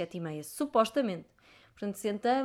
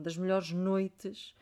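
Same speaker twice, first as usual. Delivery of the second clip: ordinary talk, nothing marked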